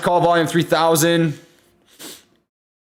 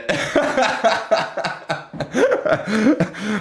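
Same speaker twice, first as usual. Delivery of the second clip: laughing fit; 6 semitones higher than usual. Real, not acted. Breaks off mid-sentence laughing.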